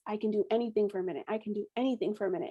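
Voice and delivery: repeating a coping mantra under breath; fast quiet mantra. Retold or imitated. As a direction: chant it quickly to get through pain. Mantra repeated through contractions.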